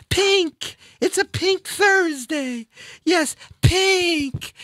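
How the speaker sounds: gushing pink lover. Pretend. The host gushes to listeners about loving pink, high and giddy. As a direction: swoon over pink like a giddy fan.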